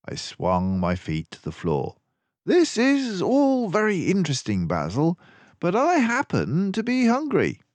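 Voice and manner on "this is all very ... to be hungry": higher, lively teasing gentleman; Dawson's voice. A narrator's reading. Dawson teasing Basil about his smug smile, lively raised voice. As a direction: tease your friend, amused and quick.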